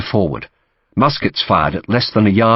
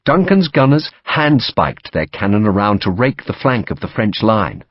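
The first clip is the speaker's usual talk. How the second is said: plain reading voice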